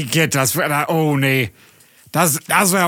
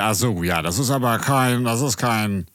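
put-on drunk. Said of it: "Weißt du, wie du klingst?".

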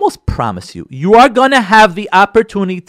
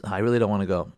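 reluctant spouse; 8 semitones lower, 8 dB quieter, faster than usual. Acted.

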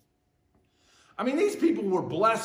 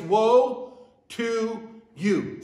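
very slow, drawn-out "Woe to you", "Woe" held long; slow solemn recitation; 4 dB louder, much slower than usual. Shown as a demonstration. Preacher leading congregation in saying it, slow. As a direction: model it slowly for the room to repeat. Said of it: "Say that with me".

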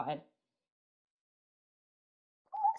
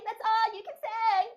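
much higher and drawn out, slower; slower Dexter quote voice; 11 semitones higher than usual. Retold or imitated. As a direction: stretch the cartoon-kid line slowly. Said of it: "just say omelette du fromage".

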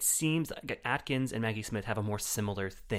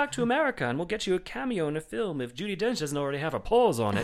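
imitating Plowright; 8 semitones higher, 5 dB louder than usual. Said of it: "Plowright says that she's like".

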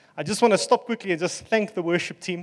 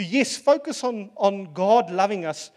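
ordinary talk, nothing marked

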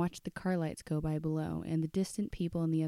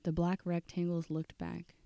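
ordinary delivery

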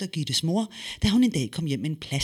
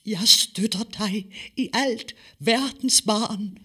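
higher, slow solemn mother; 8 semitones higher, 4 dB louder, slower than usual. Acted. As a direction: bless her daughter, slow and grand. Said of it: "sagde Fennikel højtideligt". (Fennikel taler langsomt og højtideligt til datteren)

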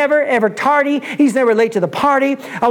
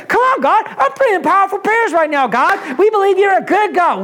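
fired-up prayer; 7 semitones higher than usual. Retold or imitated. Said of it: "And Silas goes".

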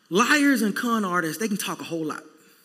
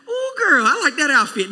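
fake flattery; 5 semitones higher, 4 dB louder than usual. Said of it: "Flattery, like".

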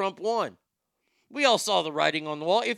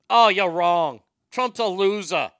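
mock Boston coworker; 8 dB louder, slower than usual. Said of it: "she was from Boston".

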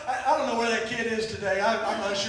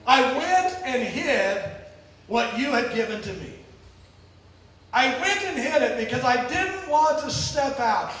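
normal talking voice

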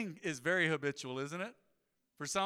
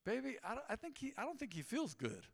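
soft, higher-pitched gentle quote of a wife; gracious wife; 5 semitones higher, 9 dB quieter than usual. Retold or imitated. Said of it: "my wife is real gracious".

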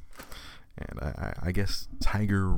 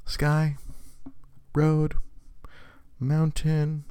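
put-on toddler naming words; 9 semitones higher, much slower than usual. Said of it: "he was sitting there going".

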